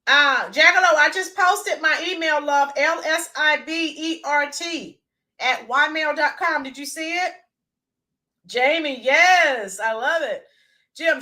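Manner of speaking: normal talking voice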